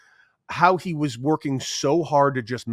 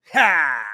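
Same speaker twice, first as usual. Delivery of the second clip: triumphant ha; 4 semitones higher, 6 dB louder than usual. Retold or imitated. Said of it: "I just do the whole".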